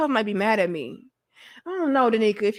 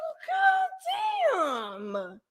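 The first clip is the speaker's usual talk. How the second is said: very slow, high, stretched God damn; high drawn-out "Well, God damn" shout; stretched-out amazed shout; 7 dB quieter, much slower than usual. Retold or imitated. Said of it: "I said".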